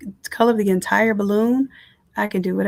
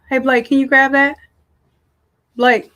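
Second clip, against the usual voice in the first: calling out; 4 semitones higher, 7 dB louder than usual. Real, not acted. Mom interrupts her tutorial for a delivery, calling out to Blake. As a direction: call out louder to get their attention. Calling child in another room.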